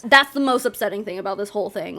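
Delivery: ordinary talk, nothing marked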